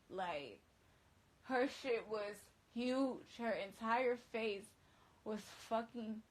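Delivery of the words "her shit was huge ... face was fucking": slow emphatic. Real, not acted. Stunned description of her face.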